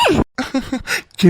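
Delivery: high chuckle at the reaction; teasing laugh. Acted. Teasing suitor laughs at her reaction.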